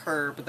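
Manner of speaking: herb with a sounded initial h. Shown as a demonstration. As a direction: breathe the h at the start of herb. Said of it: "I know there's an H".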